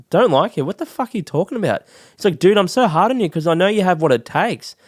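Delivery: exploding with disbelief, then reassuring hard; incredulous teacher. Retold or imitated. Acting teacher tells his student he believes in him, incredulous.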